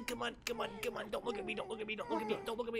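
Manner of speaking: hushed, pleading under the breath; quiet pleading. Pretend. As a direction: beg them not to look, softly. Hiding from guards, pleading.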